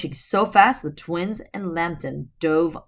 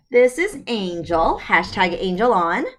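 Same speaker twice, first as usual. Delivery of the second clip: cheery pet intro; 3 semitones higher, 7 dB louder than usual. Real, not acted. Introducing her dog to viewers.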